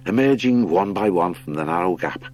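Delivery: plain reading voice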